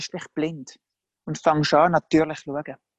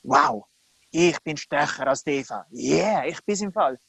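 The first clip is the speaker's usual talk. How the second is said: mock boasting, lower-pitched brag; hammed-up one-upmanship; boastful; 3 semitones lower than usual. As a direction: puff yourself up in playful self-congratulation. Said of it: "Plötzlich haben sie können sagen".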